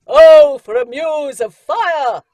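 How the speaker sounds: Oh, for a muse of fire! roared like a stage actor; stage declaiming. Shown as a demonstration. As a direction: project to the back row of a theatre. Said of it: "because you'll deafen them".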